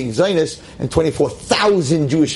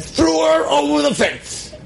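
high and slow with indignation; outraged high cry; 12 semitones higher, slower than usual. Real, not acted. Preacher telling how Ruth was thrown over the fence, high and outraged.

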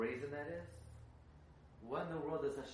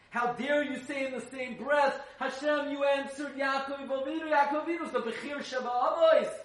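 higher, louder, laughing in disbelief; incredulous high laughing; 11 semitones higher, 11 dB louder than usual. Acted. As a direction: laugh at the nerve of it. Mock audacious address to God.

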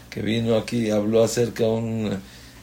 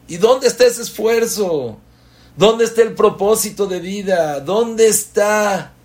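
much higher and louder; impassioned preaching; 10 semitones higher, 7 dB louder than usual. Real, not acted. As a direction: rhetorical cry to the crowd. Preacher challenges the congregation.

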